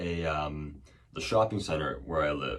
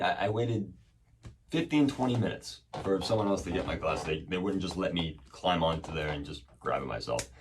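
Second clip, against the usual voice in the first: usual speaking voice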